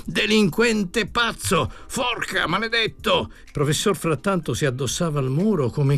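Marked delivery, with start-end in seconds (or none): 0.0-3.3 high-pitched, indignant cursing; shouted insults. A narrator's reading. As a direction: hurl curses at him, voice raised high. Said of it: "gridò il professore".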